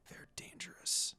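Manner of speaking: breathy whispered warning. A narrator's reading. Ayato warning Monaco under his breath, whispered. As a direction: hush it so no one overhears. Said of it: "he whispered".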